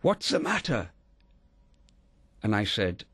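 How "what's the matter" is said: high-pitched mock shout at normal volume; retold shout. Mother running from her car to her son, retold shout. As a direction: pitch leaps up in an imitated cry of alarm. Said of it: "she shouted at me".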